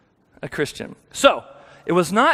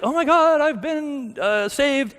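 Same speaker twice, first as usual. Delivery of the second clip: much higher, hammed-up "oh my God" thrill; mock rapture; 9 semitones higher, slower than usual. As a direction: send it up like a gushing convert. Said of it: "it didn't feel like".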